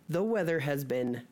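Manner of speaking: ordinary talk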